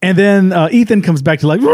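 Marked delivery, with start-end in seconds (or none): 1.6-1.7 drawn-out mock roar; imitated attack roar. Host imitating the killer coming back at them, mock roar.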